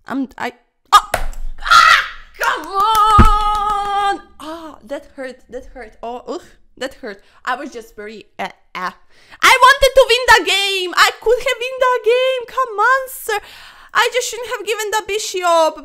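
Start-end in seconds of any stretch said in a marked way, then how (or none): 0.9-4.2 high, loud cry of dismay after a blunder; frustrated shout. Real, not acted. Player yelling at a lost chess game, frustrated. 9.4-15.8 high, loud exasperated complaining; frustrated rant. Real, not acted. Chess player ranting at himself after losing, loud.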